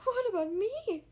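high, loud, hurt question; tearful. A narrator's reading. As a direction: plead as tears well up. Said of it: "tears welling up in his eyes".

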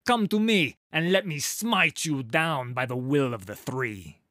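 loud, high battle cry; loud challenge. A narrator's reading. Halla challenging the creature to fight her, loud battle cry. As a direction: call the beast down with holy fury.

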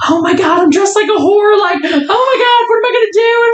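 put-on high, frantic girly panic; high-pitched mock panic; panicky girl. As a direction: squeal it out like a freaked-out girl. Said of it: "she was like".

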